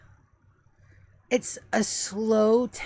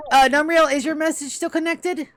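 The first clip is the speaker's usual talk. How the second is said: calling out; 7 semitones higher, 8 dB louder than usual. Acted. GM calls out to an NPC.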